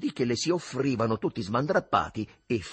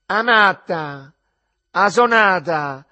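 slow, very loud, high scolding yell; shouting from offstage; 8 semitones higher, 12 dB louder, much slower than usual. Acted. The mother yells at her crying daughter from behind the screen, shouting. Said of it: "si sentì gridare".

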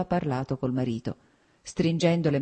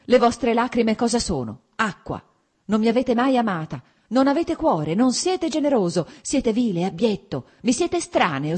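high, loud, contemptuous tirade; angry denunciation; 7 semitones higher, 4 dB louder than usual. A narrator's reading. Wife denouncing her husband, angry and contemptuous. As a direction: pile the insults on him.

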